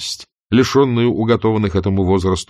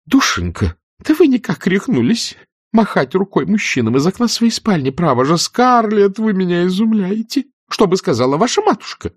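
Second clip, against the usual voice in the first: put-on high, fluttery elderly aunt voice, shocked; tut-tut at her niece, higher; fussy aunt scolding; 11 semitones higher than usual. Pitty scolds Scarlett. (высокий суетливый голос тётушки, возмущённо)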